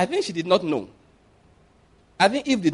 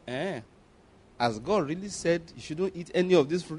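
sly hushed question; 9 dB quieter than usual. Retold or imitated. Satan questioning Eve.

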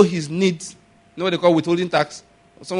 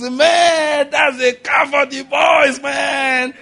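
much higher, louder and slow, delighted; old man exclaiming over the new car, loud and high; excited exclaim; 8 semitones higher, 4 dB louder, much slower than usual. Retold or imitated. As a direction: shout it out thrilled, stretching it. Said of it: "He said, the man looks".

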